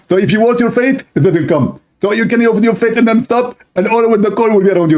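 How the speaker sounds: husband explaining eyeliner breathlessly; rambling husband. Acted. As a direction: gush it out too fast. Husband voiced rambling fast.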